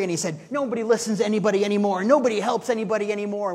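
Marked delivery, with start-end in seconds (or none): 0.5-2.0 loud, fast mock rant of a drunk; retold angry rant. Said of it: "the guy was swearing and angry". 2.0-3.5 loud, fast mock rant of a drunk; retold angry rant. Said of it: "the guy was swearing and angry".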